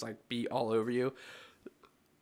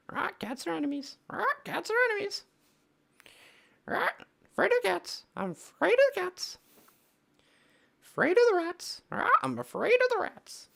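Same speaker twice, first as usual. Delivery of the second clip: very high squawking parrot; bird voice; 5 dB louder than usual. Acted. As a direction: screech it out like a parrot. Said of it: "have you never heard my bird voice before?".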